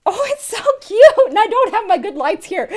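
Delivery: high, loud and laughing; squealing over how cute it is; gushing delight. Real, not acted. Gushing over palette in unboxing.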